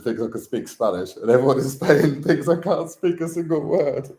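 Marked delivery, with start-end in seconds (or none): 1.2-4.1 laughing through the words, higher; amused, breaking into laughter mid-line. Real, not acted. Laughing about Spaniards doubting him.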